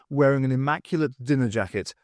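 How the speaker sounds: ordinary delivery, nothing marked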